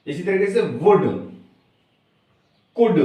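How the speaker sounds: ordinary talk, nothing marked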